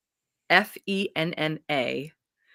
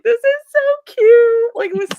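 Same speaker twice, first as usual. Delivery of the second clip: gushing delight; 12 dB louder than usual. Retold or imitated. Gushing to friends about a sweet romance hero. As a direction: melt over the cute romance. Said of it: "and I was like".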